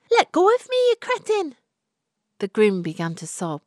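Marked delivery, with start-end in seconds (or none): 0.1-1.6 much higher, louder shriek of disgust. A narrator's reading. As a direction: scream him off you.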